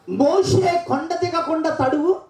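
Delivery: high, slow emphasis on Moshe; drawn-out reading. Real, not acted. Preacher slowly calling out the verse for the reader, high. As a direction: stretch each word.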